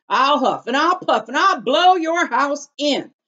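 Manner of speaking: high, loud menacing threat; coyote threat. Acted. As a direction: snarl the threat through sharp teeth.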